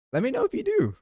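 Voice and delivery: very high squeaky voice. Pretend. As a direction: pitch it way up, cartoonish. Squeaky high aside to viewers.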